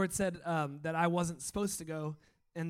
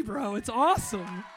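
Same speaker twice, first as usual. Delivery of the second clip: higher and much louder, overjoyed praise; excited cheering; 7 semitones higher, 9 dB louder than usual. Real, not acted. Pastor cheering on a freed worshipper, thrilled and loud. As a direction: cheer him on as he breaks free.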